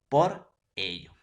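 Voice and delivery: teacher stressing the connector; emphatic answer. Shown as a demonstration. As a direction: land firmly on "por ello". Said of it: "¿Y cuál es la unión".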